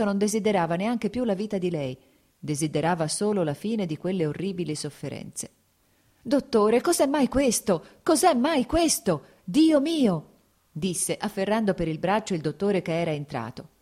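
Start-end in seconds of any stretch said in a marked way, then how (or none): 6.2-10.3 supplica angosciata, più acuta e più forte; spaventato. Acted. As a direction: implorare il dottore in preda al terrore. Lievin sconvolto afferra il dottore. (Lievin terrorizzato chiede al dottore cosa succede)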